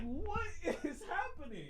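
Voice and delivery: tiny, high, very soft squeak; hushed bewilderment. Retold or imitated. Siblings all watching together, squeaky hushed disbelief. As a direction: squeak it quietly through a laugh.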